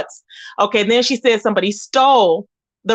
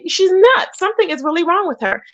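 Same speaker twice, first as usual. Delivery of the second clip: incredulous; 8 semitones higher than usual. Retold or imitated. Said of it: "I'm like".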